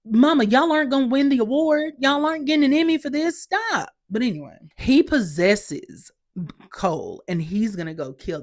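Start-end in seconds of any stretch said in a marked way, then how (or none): 0.1-3.9 high-pitched playful telling-off; mock scolding. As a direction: tease the bad actors from up high. Mock scolding of actors' accents.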